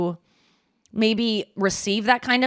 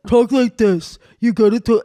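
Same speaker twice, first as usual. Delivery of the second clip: loud, resonant mock man's voice; impression of men; 4 dB louder than usual. Shown as a demonstration. Singer trying a resonant voice, mock impression of men. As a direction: put on a resonant dumb-guy voice. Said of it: "sounds like my impression of, like, men".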